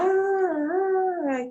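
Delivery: put-on high babbling baby sounds; baby babble. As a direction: coo like a baby finding its voice. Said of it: "Você vê que a criança fica lá". (balbucio agudo de bebê)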